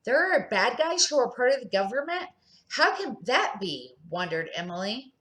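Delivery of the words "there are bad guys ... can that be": disbelief. Acted. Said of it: "jaws dropped open in disbelief".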